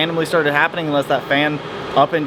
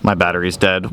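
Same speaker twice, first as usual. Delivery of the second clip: usual speaking voice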